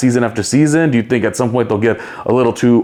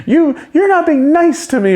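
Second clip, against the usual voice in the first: riled-up mock protest, pitched way up; Dina. Riled-up newcomer protesting to castmate, mock indignant. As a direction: imitate a high-pitched, indignant complaint. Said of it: "got Dina real riled up".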